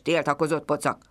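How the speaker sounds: ordinary delivery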